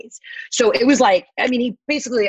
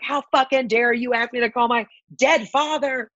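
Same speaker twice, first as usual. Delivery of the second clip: higher, mock-furious rant; acting out her rage at the cashier; hammed-up outraged threat; retold fury; 6 semitones higher than usual.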